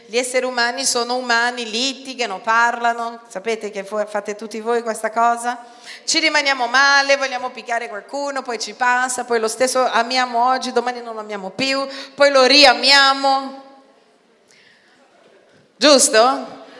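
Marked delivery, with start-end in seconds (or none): 6.0-16.6 rising, louder preaching; fervent preacher crescendo. Real, not acted. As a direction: build the sermon up to a loud peak. Preacher building to full force.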